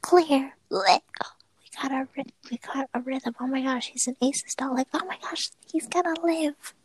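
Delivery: frantic TV doctor shouting orders; hammed-up medical drama callouts; trauma bay callouts. Acting a TV hospital scene.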